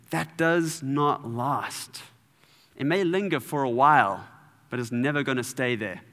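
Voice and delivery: usual speaking voice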